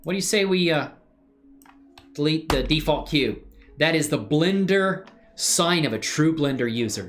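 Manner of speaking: ordinary delivery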